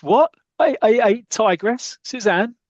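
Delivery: high, loud startled stammer; shocked Blackie. Retold or imitated. Blackie sees the tiger leap, high startled stammer. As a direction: yelp it out in disbelief. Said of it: "And Blackie goes".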